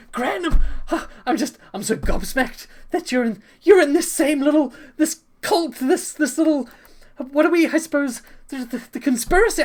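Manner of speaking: high, loud, breathless gushing; gushing fangirl. Acted. Dragonborn bard gushing over the opera star, high and loud. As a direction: gush over your idol at high pitch. Bard gushes about shared cult.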